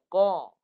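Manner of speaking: "Qa" with a thick, heavy qaf from the back of the tongue; demonstrating a heavy "Qa"; model recitation. Shown as a demonstration. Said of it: "Ikut saya".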